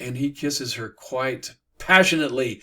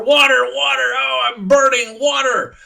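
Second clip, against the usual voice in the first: high, full-throated scream of burning agony; calling out for water; 12 semitones higher, 11 dB louder than usual. Acted. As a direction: scream for help as if scalded. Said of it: "he calls out for".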